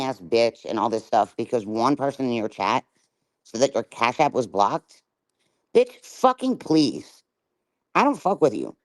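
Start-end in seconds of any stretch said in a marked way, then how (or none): 5.7-8.8 scornful dismissal. Real, not acted. Scornful rant at streamer.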